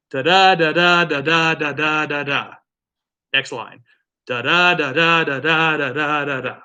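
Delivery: da da chanted in iambic beat; sing-song da da beat to show the meter; iambic rhythm. Shown as a demonstration. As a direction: tap out the meter with each da. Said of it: "it usually is like".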